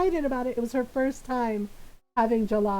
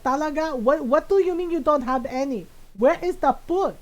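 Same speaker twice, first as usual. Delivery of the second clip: put-on indignant Filipino-accented mom, pitched up a touch; mom's Filipino accent; 2 semitones higher, 4 dB louder than usual. Mom demanding where the food is, in a Filipino accent. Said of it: "in my mom's voice".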